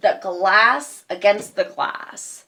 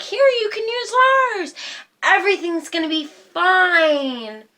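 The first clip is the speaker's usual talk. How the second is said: mock reassurance; 10 semitones higher than usual. Mocking family's promise.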